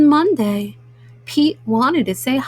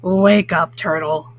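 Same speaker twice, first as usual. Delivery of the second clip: low grumpy toad voice; 6 semitones lower than usual. Acted. Toad waking the sleeping turtle, low and grumpy. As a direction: rouse the sleeper in a lowered, grumpy voice. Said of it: "says Grumpy Toad".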